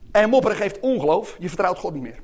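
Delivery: ordinary delivery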